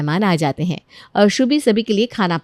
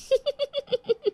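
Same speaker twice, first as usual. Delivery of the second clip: high mocking laugh; 9 dB quieter than usual. Villainess laughs.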